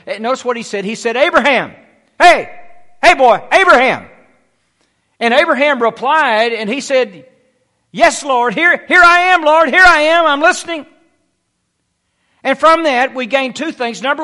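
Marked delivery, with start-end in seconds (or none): 1.2-4.1 loud, higher calls in short bursts; calling out. Acted. God calls to Abraham by name, calling out loudly. 7.9-10.9 high, loud, eager reply; eager answer. Acted. Abraham answers the Lord's call, eagerly calling back.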